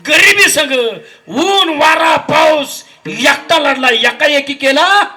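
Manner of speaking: slow, drawn-out declamation swelling into shouted peaks; impassioned preaching. Acted. Preacher honouring a tired father to the congregation, impassioned. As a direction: preach it out in long, forceful phrases.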